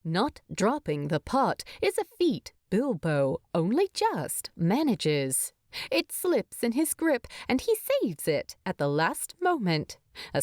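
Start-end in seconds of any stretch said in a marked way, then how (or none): none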